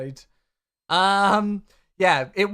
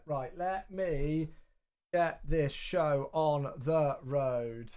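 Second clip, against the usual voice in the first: slow drawn-out; 7 semitones lower, 8 dB quieter, much slower than usual. Real, not acted. Starting the stream.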